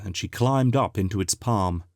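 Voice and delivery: ordinary delivery, nothing marked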